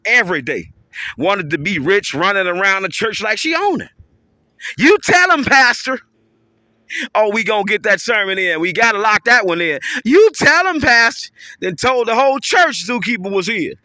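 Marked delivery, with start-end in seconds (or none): 4.8-6.0 high, loud "amen corner" shout; church call-out. Retold or imitated. 10.0-11.3 high, loud "amen corner" shout; church call-out. Retold or imitated.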